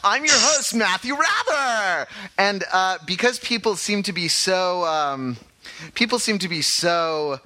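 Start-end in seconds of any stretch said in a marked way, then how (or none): 0.0-2.1 bright radio host intro; announcer. Acted.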